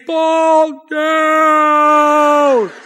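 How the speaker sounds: long, drawn-out high holler; coach yelling. Retold or imitated. Coach keeps screaming at his runner, stretched-out yell. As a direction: imitate a coach bellowing from the sideline. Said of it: "Coach is saying".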